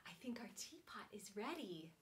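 soft whispered secret; whispered teapot is ready. Pretend. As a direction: lean in and whisper. Secret to children.

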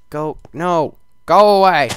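loud high go away; furious shout at the mob; angry shout. Real, not acted. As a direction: yell it at the monster. Yelling at mob.

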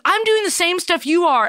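high-pitched, indignant husband imitation; mock protest. Husband insists he works as hard as his wife, mock protest. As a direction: whine it as the put-upon husband.

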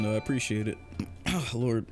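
ordinary talk, nothing marked

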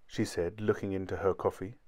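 usual speaking voice

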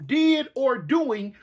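ordinary talk

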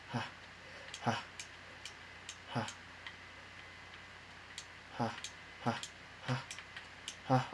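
unvoiced breathy chuckles; breathy laughing. Real, not acted. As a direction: laugh under your breath.

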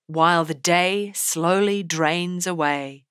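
slow, with the D in "day" and "drains" leaned on; heavy D sounds. Shown as a demonstration. Teacher showing the class the alliteration, slow and stressed. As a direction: lean into each D of "While the day slowly drains away". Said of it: "Listen to the alliteration repeating the heavy D sound".

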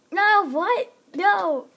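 high startled protest; shocked cry. Real, not acted. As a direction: cry out in disbelief. Shock at being killed.

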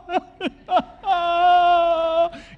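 high whiny half-sob half-laugh; mock crying wail. Preacher acting out his kid's tired wailing sob. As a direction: wail like an overtired toddler.

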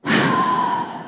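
long drawn-out sulky sigh; long emo sigh. Retold or imitated. Tennis instructor slumped against the wall, long emo sigh. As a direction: heave a theatrical moody sigh. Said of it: "this really long emo sigh".

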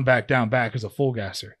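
ordinary delivery

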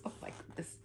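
ordinary delivery

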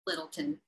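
Littleton run together, last syllable reduced to "tin"; local pronunciation. Acted. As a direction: say "Littleton" low and quick, swallowing the ending. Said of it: "That's what the locals call it".

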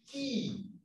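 KEY softly, with a long "eee"; sonido largo. Shown as a demonstration. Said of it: "¿Cuál es largo?". (KEY con I larga, suave)

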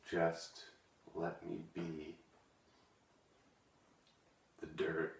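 Just let me be. dropped to a soft, hammed-up near-whisper; softest emotional. Poet whispering the final Just let me be. to viewers, overcome. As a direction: barely get out the last Just let me be. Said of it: "I get a little emotional".

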